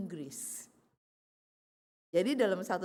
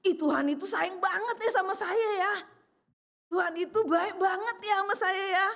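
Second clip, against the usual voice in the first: delighted gush; 9 semitones higher, 4 dB louder than usual. Retold or imitated. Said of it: "dia benar-benar berasa".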